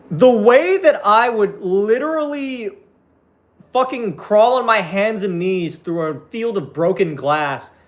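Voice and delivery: high-pitched, drawn-out fervent rant; worked up and pleading with excitement; impassioned gushing. Real, not acted. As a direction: gush with stretched-out emphasis, voice riding high. Gushing over a gender-swapped Gambit.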